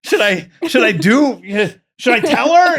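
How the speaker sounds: high, loud, pleading panic; frantic asking. Retold or imitated. Texting his sister about the ring, high frantic panic. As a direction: beg for advice in a high fluster.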